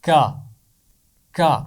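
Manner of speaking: کا said twice, short and crisp from the back of the throat; sound demo. Shown as a demonstration. Teacher shows children where کا is made. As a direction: clip کا sharply, twice. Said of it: "اس کی آواز نکلتی ہے".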